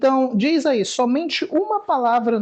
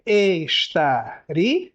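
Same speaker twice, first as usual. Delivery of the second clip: "estaria" very slow, lower, rising end; drawn out slowly; 4 semitones lower, much slower than usual. Shown as a demonstration. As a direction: estique "estaria" bem devagar. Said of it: "contêm hiato". ("estaria" arrastado, tom mais grave)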